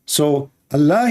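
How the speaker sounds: ordinary talk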